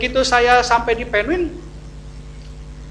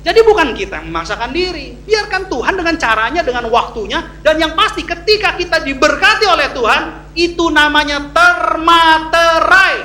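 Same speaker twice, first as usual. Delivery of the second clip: preaching at full force; 5 semitones higher, 7 dB louder than usual. Real, not acted. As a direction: proclaim it to the congregation, building to a loud finish.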